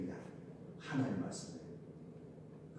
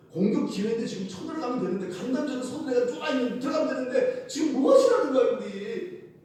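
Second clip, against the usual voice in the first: frustrated soldier; 10 semitones higher, 5 dB louder than usual. Acted. Voicing frustrated Israelites.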